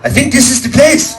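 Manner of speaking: put-on high Middle Eastern accent, eager. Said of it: "he says".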